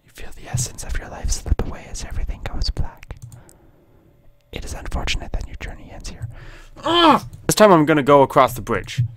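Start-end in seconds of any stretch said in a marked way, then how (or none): 0.0-6.3 hushed, ominous game-over whisper; very soft, voiceless storytelling; whispered narration. Acted. 6.9-7.3 very high short "ah"; high-pitched exclamation; high cry. Pretend. Playful cry after game over.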